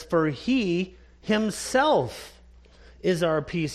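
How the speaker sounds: himself drawn out slowly and stressed; slow stress on himself in the quote; emphasis on himself. Shown as a demonstration. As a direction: stretch himself, then land on peace. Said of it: "Notice the emphasis in that verse".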